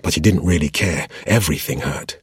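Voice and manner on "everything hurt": ordinary delivery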